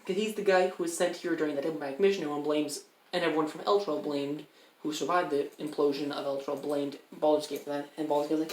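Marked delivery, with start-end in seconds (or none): none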